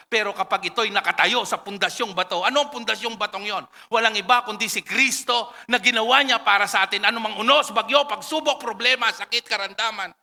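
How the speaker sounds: fervent sermon build; building preaching. Acted. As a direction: build the list to a climax.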